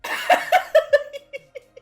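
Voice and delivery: high, loud breathless cackle; hysterical laugh. Real, not acted. Viewer cracking up at a line, wild laugh. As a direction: laugh till it hurts.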